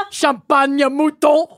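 put-on slow hammy French accent, laughing. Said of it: "foreign enunciations".

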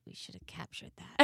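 millennial whisper. Retold or imitated. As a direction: whisper it like a missed chance. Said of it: "Millennial entrepreneur voice".

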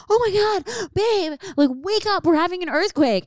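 panicked wake-up call. Retold or imitated. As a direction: shake him awake in a panic. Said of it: "And I'm like".

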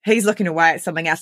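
ordinary delivery

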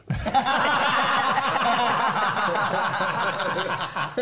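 big burst of laughing; cracking up loudly; loud laughter. Real, not acted. Laughs at joke.

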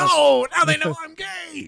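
very high, drawn-out comic wail; mock panic wail. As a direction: wail it in fake horror. Acted panic at being found out.

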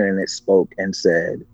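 usual speaking voice

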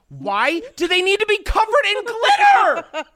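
rising disbelief at glittery nutcrackers; exasperated outburst. Real, not acted.

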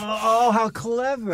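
high, loud burst of delight; delighted admiration. Real, not acted. Listener hearing how the fold-in cake worked, delighted admiration. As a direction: exclaim in high-pitched admiration.

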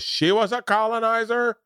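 high, offended woman's voice; indignant lady. Retold or imitated. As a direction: play an indignant heckler. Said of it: "this lady goes".

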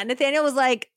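ordinary talk

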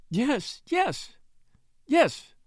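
high, rising tearful yeses; sobbing yes. A narrator's reading. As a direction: blurt it out through sobs, mildly acted. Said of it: "sobbed the widow".

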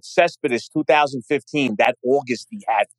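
usual speaking voice

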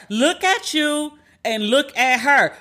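slow, every word of "Look at you and look at her" spaced out; deliberate mocking drawl; slow drawn-out repeat. Final slow repeat for effect.

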